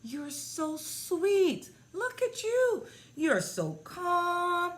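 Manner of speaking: put-on very high, slow baby-talk coo; cooing praise. Praising a pouting three-year-old on video call, high cooing baby talk. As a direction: gush sweetly as if to a toddler.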